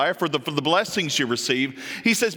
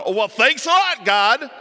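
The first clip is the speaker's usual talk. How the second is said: mock sarcastic thanks, higher and louder; a sarcastic little thank-you; 7 semitones higher, 6 dB louder than usual. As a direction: roll your eyes at God. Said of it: "it's usually in a sarcastic tone".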